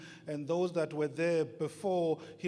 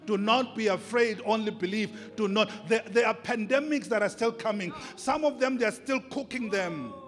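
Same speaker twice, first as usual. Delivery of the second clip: loud, higher, fervent sermon delivery; full-force preaching; 7 semitones higher, 9 dB louder than usual. Acted. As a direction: preach it out with rising fire.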